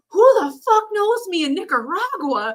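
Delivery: high-pitched mock disbelief; incredulous. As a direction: exclaim it high, as if baffled. Acts out startled inner reaction to recognition.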